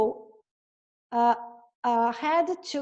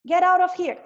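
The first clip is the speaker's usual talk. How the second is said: higher and louder angry shout; 6 semitones higher, 6 dB louder than usual. Acted. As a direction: yell at the wolf to leave. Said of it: "She cried".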